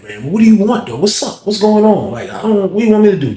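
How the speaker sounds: high, pressing, exasperated questions; exasperated questioning. Retold or imitated. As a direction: demand to know what he wants from you. Retells his exasperated questioning.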